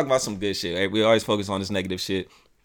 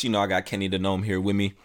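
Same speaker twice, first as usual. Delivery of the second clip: ordinary talk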